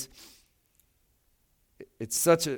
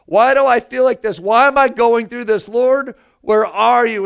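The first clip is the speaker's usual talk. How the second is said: crying out; 9 semitones higher, 10 dB louder than usual. Retold or imitated. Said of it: "I've cried out".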